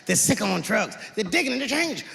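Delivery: ordinary delivery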